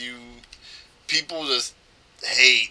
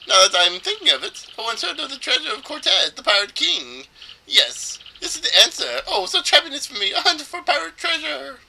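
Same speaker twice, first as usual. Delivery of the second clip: high, theatrical swooping voice; fey, flamboyant trader; 12 semitones higher, 7 dB louder than usual. Acted. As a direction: gush with over-the-top delight, pitched way up. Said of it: "make this guy sound as fey as possible".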